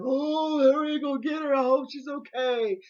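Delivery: re-enacted panicked holler, high and loud; loud, high cry of alarm. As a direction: call out urgently for help, mimicking the onlookers. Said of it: "and they were hollering".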